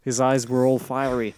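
usual speaking voice

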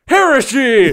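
high, loud accusing yell; shouting heresy. Retold or imitated. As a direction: yell it like a zealot. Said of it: "then he was like".

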